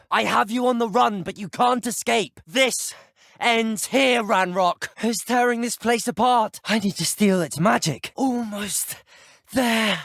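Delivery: high, straining combat cries; battle shouting. Acted. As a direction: call out over a raging fight. Climax of boss battle.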